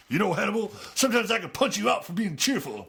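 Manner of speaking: high, loud gruff tough guy; growling B.A. Acted. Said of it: "B A growled".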